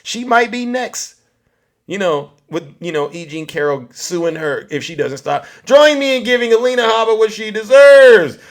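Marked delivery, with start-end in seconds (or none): 5.6-8.4 rallying shout. Real, not acted. Host calling viewers to boo the lawyer, rallying shout. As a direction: whip the crowd up into booing. Rallies audience to boo Habba.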